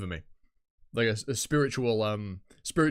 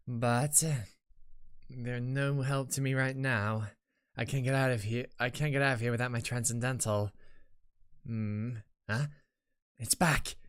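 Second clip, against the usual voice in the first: measured in-character reading with mild urgency at the end; lightly voiced game character. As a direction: read the game heroine's thoughts aloud, lightly acted.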